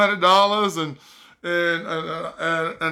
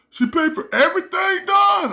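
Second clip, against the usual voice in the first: amazed friend; 13 semitones higher than usual. Retold or imitated. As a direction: shout it in disbelief.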